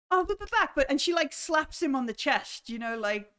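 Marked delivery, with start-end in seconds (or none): 0.1-0.7 put-on higher, eager young voice; keen to introduce friends, bouncing along; eager Ninjak. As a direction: Ninjak eagerly asking to see his auntie first. Ninjak protesting.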